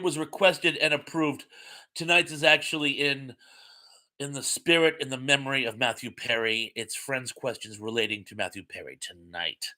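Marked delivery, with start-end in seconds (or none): none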